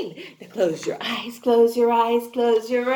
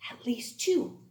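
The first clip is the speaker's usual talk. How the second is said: unvoiced stage whisper; 4 semitones lower, 7 dB quieter than usual. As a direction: whisper it like a secret. Whispered answer to class.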